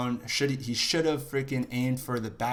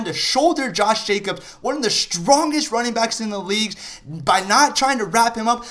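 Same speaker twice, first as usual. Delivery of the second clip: higher and louder, rising into anger; building frustration; 6 semitones higher, 6 dB louder than usual. Real, not acted. Fan ranting at the defense over the missed tackle, building anger. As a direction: work yourself up, getting hotter every line.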